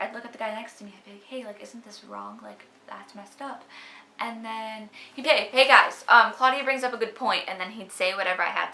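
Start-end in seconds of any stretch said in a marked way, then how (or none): 1.2-3.6 whispered aside to the guy beside her; hushed aside. Retold or imitated. To the guy next to her in class, softer hushed aside. 5.3-7.4 louder, higher call for attention; mock him hailing the whole group. The guy next to her gets the group's attention, louder mock call.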